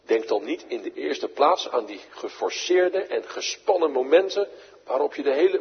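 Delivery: ordinary talk, nothing marked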